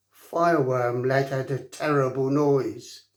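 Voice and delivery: usual speaking voice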